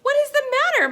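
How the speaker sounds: high, loud friendly question; kindly question. A narrator's reading. Little blue engine kindly asking the toys what's wrong. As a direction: ask brightly up high.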